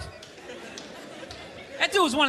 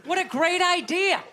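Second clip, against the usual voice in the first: higher, slow fake cheer; forced agreement; 5 semitones higher than usual. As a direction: agree through clenched sarcasm. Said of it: "You agree".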